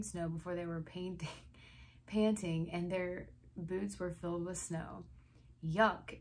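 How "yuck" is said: louder, disgusted snap; snapping. A narrator's reading. Reader voicing a boy with snow in boots, snapping. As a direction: spit it out in storybook disgust. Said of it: "Eddie snapped".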